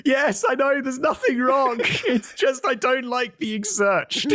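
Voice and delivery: high, slow, nervous squeak with a laugh; nervous reply. Retold or imitated. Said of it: "You seem a bit nervous".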